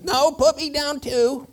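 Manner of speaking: high, loud, drawn-out candle voice; second candle. Acted. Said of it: "it says".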